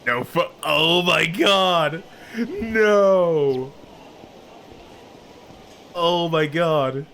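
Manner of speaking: loud, high, drawn-out shock; high, stretched cry of disbelief; dismayed outcry. Real, not acted. Sudden dismay at the game.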